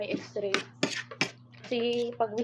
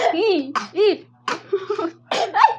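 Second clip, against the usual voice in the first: startled cries; 9 semitones higher, 10 dB louder, much slower than usual. Real, not acted. Sudden startled exclamations.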